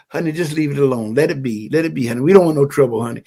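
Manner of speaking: low, soft, hushed pleading in imitation; mock timid partner. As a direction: play an old-style peacekeeper soothing their honey. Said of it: "whole program of".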